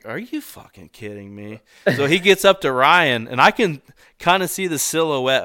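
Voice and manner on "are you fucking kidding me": low, hushed retold incredulity; muttered disbelief. Hunter watching the man sprint past, hushed disbelief. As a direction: mutter it softly to yourself, stunned.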